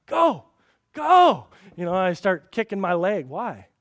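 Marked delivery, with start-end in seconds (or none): 0.1-1.5 much higher, louder urging; sideline cheer. Retold or imitated. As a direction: cheer your boys on from the touchline.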